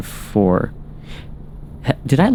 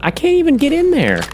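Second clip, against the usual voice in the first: squeaky frustrated cry; faster than usual. Real, not acted. Frustrated at blocked spot.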